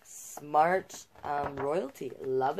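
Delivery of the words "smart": plain reading voice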